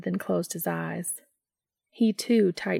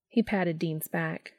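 usual speaking voice

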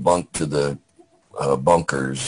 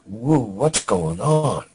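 high, slow, drawn-out surprised whoa; startled whoa; 7 semitones higher, much slower than usual. Retold or imitated. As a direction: pull back, caught off guard by the news. Startled reply to the daughter's news.

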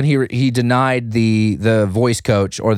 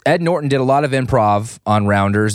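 ordinary talk, nothing marked

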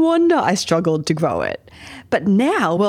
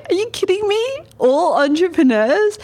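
incredulous; 11 semitones higher than usual. Retold or imitated. Mocking her own past answer.

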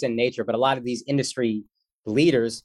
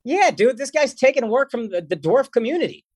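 high, mock-outraged voice; hammed-up outrage at a rival actor, mock indignant; mock indignant rant; 10 semitones higher than usual. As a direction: pretend outrage on the dwarfs' behalf. Mock rant at Peter Dinklage.

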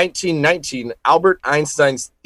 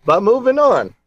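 upbeat; 8 semitones higher than usual. Acted. Echoes the phrase.

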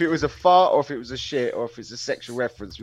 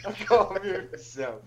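slow words squeezed through laughter; helpless real laughter; 7 semitones higher, 6 dB quieter, slower than usual. Real, not acted. Co-host apologising for spoiling the take, helplessly laughing. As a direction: try to speak while cracking up.

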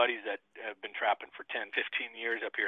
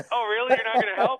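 mock disbelief; 12 semitones higher, 7 dB louder than usual. Mock incredulous retort to officer.

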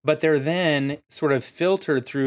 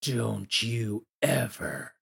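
snarled threat; 2 semitones lower, 6 dB quieter, much slower than usual. Acted. Someone nose to nose with you, snarling. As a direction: snarl it softly and slowly, as if nose to nose. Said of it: "like, snarled at you".

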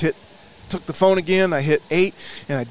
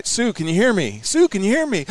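higher, urgent repeated calling; straining to be heard on a bad line; calling into phone; 8 semitones higher than usual.

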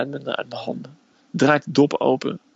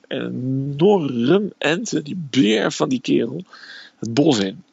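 ordinary talk

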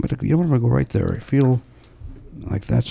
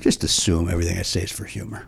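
breathy low whisper; 7 semitones lower, 7 dB quieter than usual. Real, not acted. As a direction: say it under your breath. Whispered aside.

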